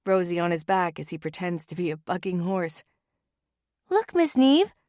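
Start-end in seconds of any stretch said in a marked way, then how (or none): 3.9-4.7 put-on high, excited child's voice; a giggly young girl showing off; bright little-girl voice, much higher; little girl laughing. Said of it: "Rosie laughs wildly".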